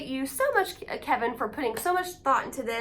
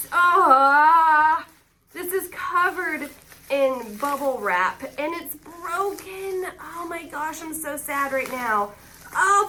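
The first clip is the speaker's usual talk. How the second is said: usual speaking voice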